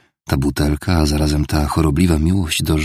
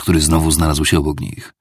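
ordinary delivery, nothing marked

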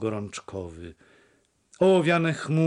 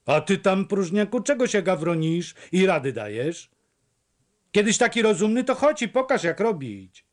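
flared-up retort; 7 semitones higher than usual. A narrator's reading. Fisherman snapping at the stranger behind the fence, angry. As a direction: bristle at the stranger's advice. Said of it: "wnet się zaperzył".

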